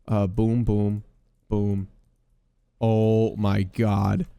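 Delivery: ordinary talk, nothing marked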